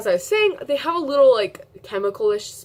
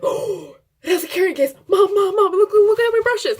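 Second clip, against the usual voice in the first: much higher and louder, laughing shriek; excited shouting; 12 semitones higher, 9 dB louder than usual. Retold or imitated. To her mom, showing off the new brush case, shrieking with laughter. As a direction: call Mom over, overjoyed and laughing.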